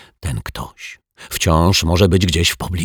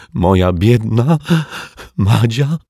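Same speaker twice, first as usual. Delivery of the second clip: put-on high, slow, tearful little girl's whimper; a child lightly sobbing over her lost pet; 4 semitones higher, 4 dB louder, slower than usual. A narrator's reading. Little Asia sobs to the detective, high and tearful. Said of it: "wychlipała Asia".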